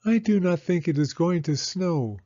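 put-on high child's voice, a touch downcast; a small girl giving up hope; little girl Penny. Penny telling Rose it won't snow, high childlike voice. Penny tells her parents.

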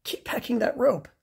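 urging his friend to keep going; encouraging Bernard. Acted. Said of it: "said Bernard".

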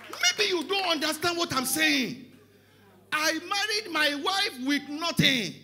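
slow, high, loud insistence; full-force preaching. Real, not acted. Preacher insisting to the congregation about his poverty, full-force preaching. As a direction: drive each word home loudly.

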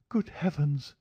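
high-pitched, shocked lady; frightened woman's high voice; alarmed cry. A narrator's reading. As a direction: exclaim in alarm without raising the volume. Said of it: "she cried".

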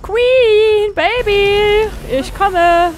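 loud, drawn-out call. Real, not acted. Player calls to Queen on the way home, calling out. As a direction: holler to a pet that you are on the way.

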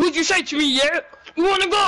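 high-pitched mock confrontation; playing his friend. Boy playing his friend challenging Alfie, mock confrontational. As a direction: push the challenge up high, squaring up. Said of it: "let me be you".

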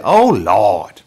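loud, hammed-up Alabama Southern accent; butchered Southern accent. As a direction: imitate a Southern grandmother exclaiming, badly butchered accent. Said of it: "I'm butchering the Southern accent".

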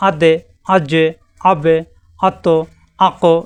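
each sakin letter in আদে, আজে, আবে, আত্ত, আকো released with a short bouncing knock; qalqala bounce. Shown as a demonstration. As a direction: bounce the stopped letter like a tap. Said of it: "এরকম বাড়ি খাবে". (কলকলা, প্রতিটি সাকিন হরফে হালকা ধাক্কা)

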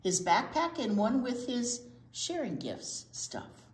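put-on lively read-aloud for children; storybook reading. A narrator's reading. Teacher reading a picture book to the kids, lively read-aloud.

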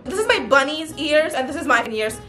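usual speaking voice

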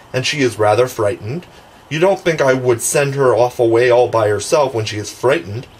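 ordinary delivery

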